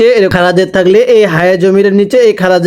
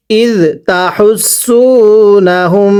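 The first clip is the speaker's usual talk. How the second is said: ইদ তাহুসুনাহুম drawn out very slowly, each syllable long; slow recitation; much slower than usual. Shown as a demonstration. Teacher models the phrase for students, slow careful recitation. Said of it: "পড়ুন".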